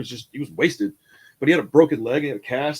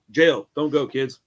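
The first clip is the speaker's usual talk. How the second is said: usual speaking voice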